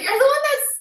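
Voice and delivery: high-pitched retold accusation; indignant comeback. Tenant turns it back on the neighbour, indignant and high. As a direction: turn the blame around in a high voice.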